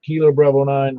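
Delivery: ordinary delivery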